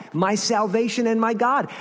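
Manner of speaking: high, defiant declaration; talking back. Acted. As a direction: throw it back at the accuser. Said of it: "That's his talking back".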